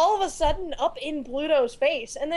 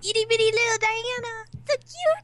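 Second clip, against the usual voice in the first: gushing over tiny Diana; 10 semitones higher than usual. Real, not acted. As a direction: coo it like over a puppy. Gushing over cute character.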